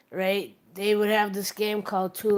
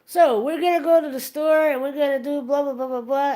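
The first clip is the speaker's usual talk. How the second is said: imitating rambling improviser; 6 semitones higher, 5 dB louder than usual. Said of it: "So you're like".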